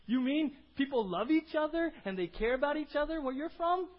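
high, loud, eager questioning; amazed outsider. Acted. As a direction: act out someone astonished and wanting in. Voices amazed outsider asking to come.

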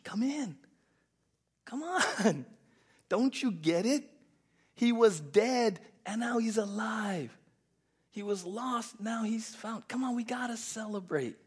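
high, loud pleading with a laugh; father begging son to join. Retold or imitated. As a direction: urge him to come celebrate. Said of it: "The father was pleading".

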